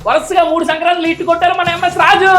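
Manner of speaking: high, ringing fan cheer; high-pitched chant with stretched endings; hyped slogan. Acted. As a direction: proclaim it like a rhyming slogan. Hypes producer in rhyming slogan.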